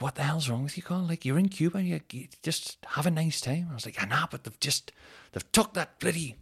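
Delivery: a hint of wife telling him off; imitating his wife's exasperation; mock wife. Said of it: "Sophie's like".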